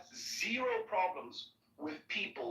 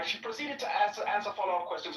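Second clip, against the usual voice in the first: ordinary delivery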